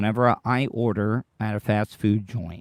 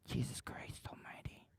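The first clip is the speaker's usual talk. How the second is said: breathy whispered curse under the breath, much softer; exasperated whisper; 23 dB quieter than usual. Real, not acted. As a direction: mutter it to yourself, fed up.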